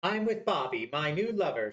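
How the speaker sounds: ordinary delivery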